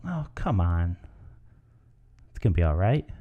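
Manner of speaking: soft, low reassurance; consoling. Shown as a demonstration. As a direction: comfort a friend who had it hard. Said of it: "Cheer up".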